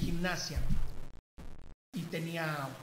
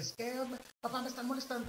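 higher, softer child's voice, lightly whiny imitation; mock tearful child; 6 semitones higher, 5 dB quieter than usual. Son complaining to dad about being bullied, mock tearful. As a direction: imitate an upset little boy, small and high. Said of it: "viene llorando y me dice".

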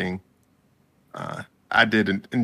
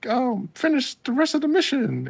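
much higher cartoon penguin puppet voice; Agent Penguin voice. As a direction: squeak it out like a toy penguin playing spy. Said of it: "he plays A-, Agent Penguin and was like".

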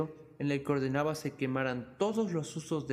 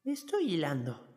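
put-on slow, crackly old spinner; old woman voice; 2 semitones higher than usual. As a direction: answer slowly like an old crone. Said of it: "respondió la vieja".

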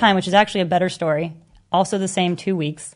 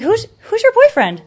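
high and louder, feigning total innocence; mock innocent; 13 semitones higher, 7 dB louder than usual. To the jealous girl, loud mock innocent question. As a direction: act clueless, pitch it way up. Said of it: "I'm the most innocent person".

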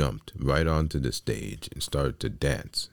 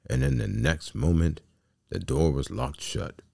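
usual speaking voice

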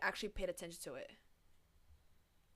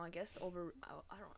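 whispered, unsure; 2 semitones lower, 10 dB quieter than usual. Real, not acted. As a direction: whisper it reluctantly. Reluctant answer.

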